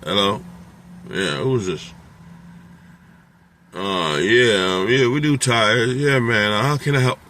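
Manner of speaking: lightly voiced groggy, casual pickup; sloppy phone answer. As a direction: answer like you just woke up, careless. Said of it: "You can't be like".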